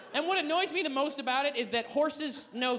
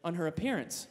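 normal talking voice

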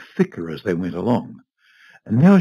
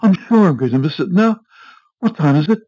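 high, louder worried voice; anxious fretting; 8 semitones higher, 5 dB louder than usual. A narrator's reading. Wife afraid of missing her flight, fretting anxiously. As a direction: fret aloud in rising panic. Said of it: "cried Missus Foster".

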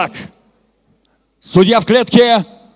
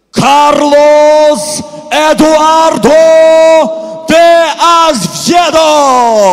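drawn-out ring announcement; 4 semitones higher, 4 dB louder, much slower than usual. Acted. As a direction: stretch the name out slowly. Announcing fighter name. (растянутое объявление имени бойца)

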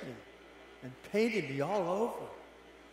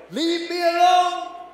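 high, loud anguished yell; 16 dB louder, much slower than usual. Acted. Teacup yelling at its master, desperate. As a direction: scream it out, begging to be left alone. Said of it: "and I yelled".

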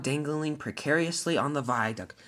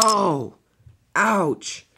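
crash landing cries; 4 semitones higher, 6 dB louder than usual. Acted. Diesel ten crash-lands.

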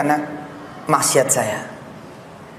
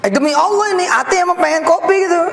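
much higher, eager and amazed; amazed that coffee came; excited; 11 semitones higher than usual. Real, not acted. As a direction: swear it in delight. Swearing he really wanted coffee.

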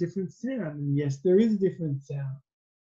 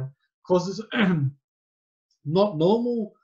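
ordinary talk, nothing marked

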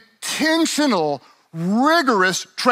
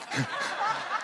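high silly giggle; 11 semitones higher, 13 dB quieter than usual. Retold or imitated. Mock runner's giggle.

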